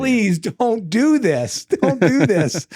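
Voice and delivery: exaggerated desperate mentor begging; hammed-up pleading. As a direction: plead theatrically, stretching every word. Developer begging him to stop.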